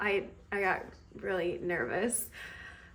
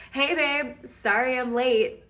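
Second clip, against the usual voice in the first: raised, higher voice, hammed-up; loud mock greeting; 4 semitones higher, 5 dB louder than usual. Stranger posing as her boyfriend to fool creep, loudly. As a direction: greet a sweetheart loudly for show.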